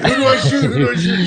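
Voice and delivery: hammed-up confusion, trank gun in hand; mock panicked question. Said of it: "and he's like".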